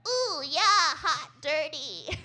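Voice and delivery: high breathy mock-sexy moan; hammed-up porn moan, high and loud; exaggerated sultry moaning, high. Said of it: "kind of hot".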